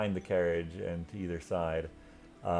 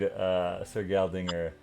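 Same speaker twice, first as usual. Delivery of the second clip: ordinary talk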